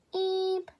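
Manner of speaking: very high, loud eep; squeak. Acted. Baby crow answering grandfather, high squeak. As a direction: squeak like a tiny bird.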